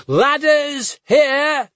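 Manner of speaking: high, loud, slow shouted order; shouting. A narrator's reading. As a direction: shout it over the battle. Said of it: "he shouted".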